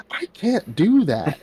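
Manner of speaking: high, drawn-out imitation of screaming; hammed-up scream of refusal; mock scream. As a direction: act out her shrieked protest, slowly stretched. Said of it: "she starts screaming".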